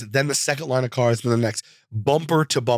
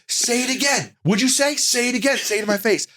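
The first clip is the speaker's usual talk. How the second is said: much higher mock menacing demand; imitating wrestler's threat; 10 semitones higher than usual. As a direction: get in his face, goading him to repeat it. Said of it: "He was like".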